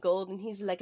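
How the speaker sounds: ordinary delivery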